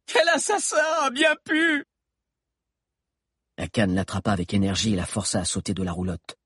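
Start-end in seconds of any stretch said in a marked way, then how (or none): 0.1-1.8 high-pitched shouted outrage; hysterical outburst. A narrator's reading. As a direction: explode on the edge of hysteria. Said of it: "au bord de l'hystérie, explosa".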